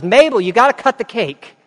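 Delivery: high, loud call across the room; yelling. Retold or imitated. Partygoer yelling to Mabel at her party, loud. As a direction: shout it over the party noise. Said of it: "somebody yells".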